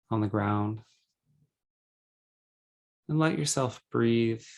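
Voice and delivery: calm, measured meditation guide with long pauses; guided meditation. Acted. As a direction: guide them gently into breathing, unhurried.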